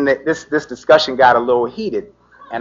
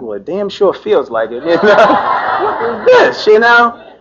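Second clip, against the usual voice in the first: laughing; 7 semitones higher than usual. Real, not acted. Laughing at being cuffed.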